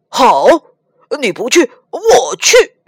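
loud, furious, voice cracking on the last words; angry outburst. Acted. Pigsy furious at Monkey's refusal, angry shout. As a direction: snap it back like a wounded pig spirit. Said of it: "猪八戒怒上心头".

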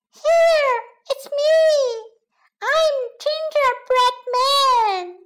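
high, slow storybook creature; gingerbread man voice. A narrator's reading. Gingerbread man announces himself from oven, high calling voice. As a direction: pipe up high and drawn out. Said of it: "烤箱里有个声音说".

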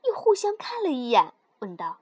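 ordinary delivery, nothing marked

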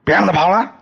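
loud, high yell after a fleeing man; shouting. Acted. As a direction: bellow the order to chase. Thug yells to catch him. (高声怒喊)

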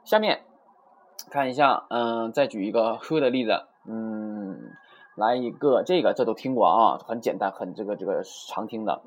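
ordinary talk

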